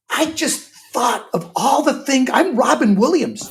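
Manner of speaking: high, drawn-out wounded outrage; Robin Williams, losing it. Retold or imitated. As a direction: act out an indignant star's meltdown, loud and slow. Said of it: "he genuinely had a moment".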